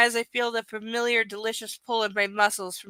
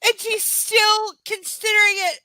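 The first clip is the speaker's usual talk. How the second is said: higher, louder disbelief; exasperated outburst; 10 semitones higher, 7 dB louder than usual. Real, not acted. Mocking the heroine with co-host, exasperated. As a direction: throw up your hands in disbelief.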